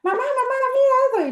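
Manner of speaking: very high-pitched imitation of an eager caller; mimicked high, excited calling; excited call to mamá. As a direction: call out high for mamá's attention. Quoting someone calling mamá.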